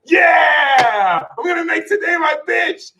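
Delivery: cackling mock gym-bro hype; hyped-up Leo. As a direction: yell like you're fired up at dawn. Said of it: "Motherfuckers just mad inspired".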